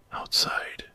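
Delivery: usual speaking voice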